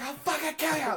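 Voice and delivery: very high mock yell; freaking-out cousin. Cousin threatening a stranger in retelling, high frenzied yell. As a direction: squeal the threat at full tilt, imitating a kid. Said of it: "freaking out".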